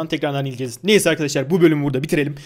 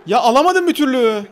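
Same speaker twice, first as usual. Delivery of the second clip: higher, louder frustrated cry; exasperated yelp, higher and louder; 9 semitones higher, 5 dB louder than usual. Real, not acted. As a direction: blurt out the frustration at losing the ball. Frustrated at the game.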